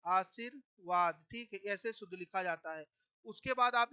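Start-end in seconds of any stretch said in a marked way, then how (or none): 0.0-1.2 slow spelled-out word. Shown as a demonstration. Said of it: "और लिखते हैं आशीर्वाद".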